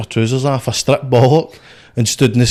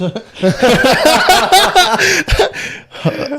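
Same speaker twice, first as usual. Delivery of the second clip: burst of laughter. Real, not acted. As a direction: crack up at your own joke. Laughs after his own line.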